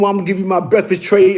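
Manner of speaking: normal talking voice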